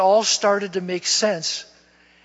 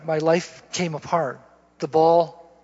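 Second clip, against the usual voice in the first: ordinary talk, nothing marked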